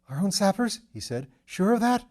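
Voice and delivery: high, pleading question; cling to the reassurance; anxious. Acted. Feverish lord asks his servant, high and anxious.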